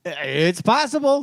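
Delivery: high, slow teasing drawl; slow teasing sing-song. Pretend. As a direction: stretch it out slowly, pitched high. Teasing repeated claim.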